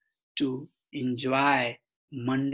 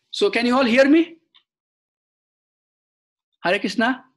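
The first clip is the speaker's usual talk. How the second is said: raised, higher voice checking for listeners; calling out; 8 semitones higher, 10 dB louder, faster than usual. Real, not acted. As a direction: call out loudly to see who can hear. Checking if listeners online can hear.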